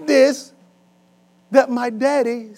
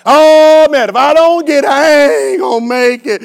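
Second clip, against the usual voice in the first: mock desperate whine; 4 semitones higher, 6 dB louder than usual. Believer desperate over chicken sandwich.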